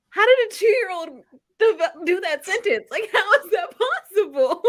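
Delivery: put-on aunt marvelling at a talking toddler; amazed disbelief. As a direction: gasp it out in laughing amazement.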